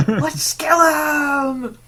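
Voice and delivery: high, drawn-out hammy battle cry; mock villain catchphrase, stretched and loud; Strife. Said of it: "versus Strife, who's just like".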